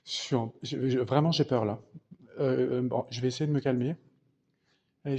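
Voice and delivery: normal talking voice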